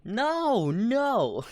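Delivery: high-pitched, drawn-out protest; flustered, high objection; protesting denial. Real, not acted. Denying he targets Asians.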